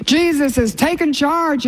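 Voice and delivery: high, slow triumphant declaration; exultant proclamation. Real, not acted. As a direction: proclaim it up high, savoring each word. Exultant proclamation after hallelujahs.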